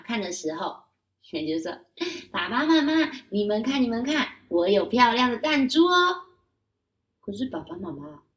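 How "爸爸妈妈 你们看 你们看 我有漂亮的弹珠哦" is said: put-on high, louder child voice showing off; proud child. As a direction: show the marbles off proudly. Child shows marbles to parents. (高声童音，得意炫耀)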